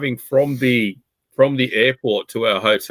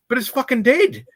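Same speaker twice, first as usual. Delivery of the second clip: high-pitched mock protest; high, baffled objection; imitating incredulous driver; 11 semitones higher than usual. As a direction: mimic appalled disbelief, voice well up. Acting out the driver's disbelief.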